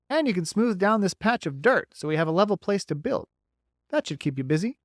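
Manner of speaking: bright, folksy beaver. Acted.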